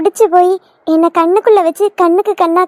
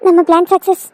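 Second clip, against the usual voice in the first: relieved scheming. Acted. Schemer alone after plan works.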